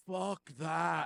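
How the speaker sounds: priest's loud mock Irish refusal; Irish accent. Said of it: "in an Irish accent".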